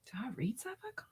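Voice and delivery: soft unvoiced whisper. Real, not acted. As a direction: whisper it under your breath. Reading chat question aside.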